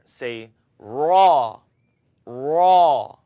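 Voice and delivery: raw said loud with a full open a; teacher demo. Shown as a demonstration. Teacher calls out raw for students to repeat. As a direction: model raw loudly for the class. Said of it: "Let's try it together".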